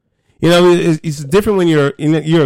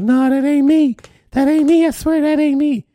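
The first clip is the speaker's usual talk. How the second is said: pleading high voice, insisting it's not him; high-pitched denial; 12 semitones higher than usual. Retold or imitated. To friends who caught him, a high pleading denial. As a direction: squeak out the denial like a kid caught out. Denying he sold drugs.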